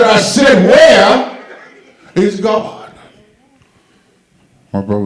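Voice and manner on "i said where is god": loud, high shout of the question; pastor shouting. Retold or imitated. Pastor yells at little brother, booming shout. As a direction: bellow it like a furious preacher.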